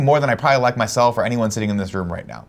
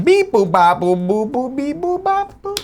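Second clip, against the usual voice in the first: high, slow beeping robot; toy robot voice; slower than usual. Shown as a demonstration. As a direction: chirp each beep like a toy robot. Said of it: "Let me do it".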